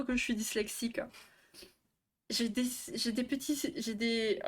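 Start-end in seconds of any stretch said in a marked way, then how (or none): 2.3-4.4 stuttered false starts; tripping over the opening words; stammering. Real, not acted. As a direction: stumble and restart the phrase. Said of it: "elle bégaye, elle bégaye".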